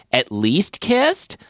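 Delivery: slow rising disbelief; incredulous. Retold or imitated. Incredulous quoted echo.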